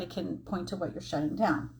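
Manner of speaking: usual speaking voice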